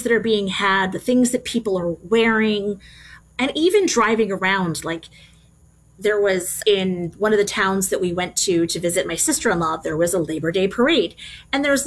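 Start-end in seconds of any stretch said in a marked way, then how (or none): none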